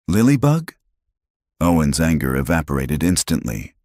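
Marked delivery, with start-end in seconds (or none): 0.1-0.7 sudden fear. A narrator's reading. Father sees sick daughter.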